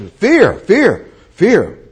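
loud, high single-word yells; dramatised frightened outcry; acted cry of fear. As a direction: yell it out in bursts, dropping each time. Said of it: "They yelled".

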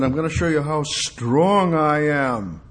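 drawn-out, swelling emphasis on strong; voice of God boasting. Acted. Preacher voicing God reassuring Elijah, drawn out and powerful.

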